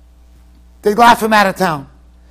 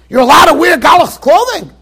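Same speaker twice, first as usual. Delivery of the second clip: indignant shout; 6 semitones higher, 7 dB louder than usual. Retold or imitated. Rabbis confront Rav Pesach.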